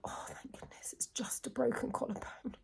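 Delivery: whispered relief, as if to herself; relieved whisper. Retold or imitated. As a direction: breathe it out in a secret whisper. Retold relieved inner thought.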